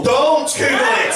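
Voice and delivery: high, drawn-out alarmed shout; panicked dad yell. Retold or imitated. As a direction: cry out to stop the child, slowly stretched. Dad panicking at child's awkward question.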